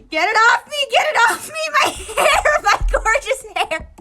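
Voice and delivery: loud, high frantic cries; panicked shrieking. Acted. Okawa blinded by towels, shrieking in panic. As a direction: flail and shriek. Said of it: "running around aimlessly".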